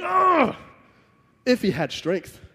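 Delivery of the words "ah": high, short cry of pain; imagined scream. Retold or imitated. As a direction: let out a sudden pained "Ah". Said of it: "Just imagine Jesus just screaming".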